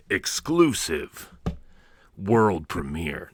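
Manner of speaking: slow, drawn-out movie trailer voice on "exclusive world premiere"; mock trailer announcer. Host hyping a merch tier to cohosts, mock trailer announcer. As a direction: sell "exclusive" like a big premiere announcer, very slowly.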